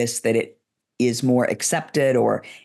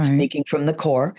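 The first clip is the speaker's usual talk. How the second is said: ordinary talk, nothing marked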